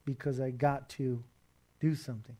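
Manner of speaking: ordinary talk, nothing marked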